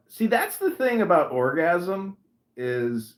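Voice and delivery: ordinary talk, nothing marked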